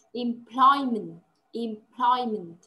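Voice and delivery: "employment" very slow with the weight on "ploy", falling at the end; stress on ploy. Shown as a demonstration. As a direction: stretch out "employment" and hit the "ploy" syllable. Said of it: "trọng âm rơi vào từ ploy". (đọc thật chậm "employment", nhấn mạnh âm "ploy")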